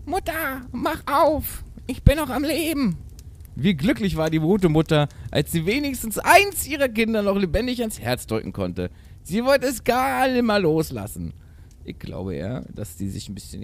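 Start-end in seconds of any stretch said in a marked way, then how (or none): 0.0-3.0 very high little goat voice; tiny bleating kid goat. A narrator's reading. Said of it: "ein feines Stimmlein meckerte". 3.5-11.3 high, animated fairy-tale narration; theatrical storytelling. A narrator's reading. Reads the tale aloud.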